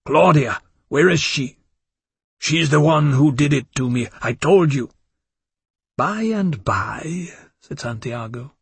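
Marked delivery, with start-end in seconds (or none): 0.0-4.9 loud, frantic outburst; bursting out. Acted. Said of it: "he burst out". 6.0-7.3 whispering. Acted. Vampire elder's cold reply.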